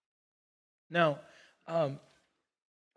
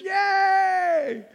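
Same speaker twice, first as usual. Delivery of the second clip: much higher, joyful mock cheer; cheering God; 11 semitones higher, 3 dB louder than usual. Pastor voicing God cheering when you wake up, high joyful shout. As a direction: cheer for them like a proud parent.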